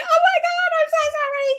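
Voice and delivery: mock panicked apology. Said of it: "and I'm like".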